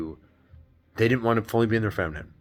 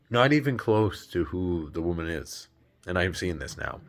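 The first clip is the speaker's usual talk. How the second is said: usual speaking voice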